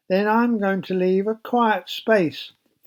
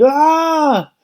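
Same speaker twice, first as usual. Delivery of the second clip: high startled scream "Ah!"; a short high shriek of fright; 10 semitones higher, 6 dB louder than usual. Acted. Rhoda hearing Peter's voice, screaming high. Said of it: "Rhoda screamed".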